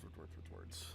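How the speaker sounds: whispering the words away; whispered trailing off. Real, not acted. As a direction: let it fade into a whisper. Searching for a word, trailing off.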